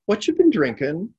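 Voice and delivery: high, loud, cheerful question; light, interested. A narrator's reading. Old man asking the drunk, light and interested. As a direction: ask it with sparkling curiosity. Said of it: "he asked lightly".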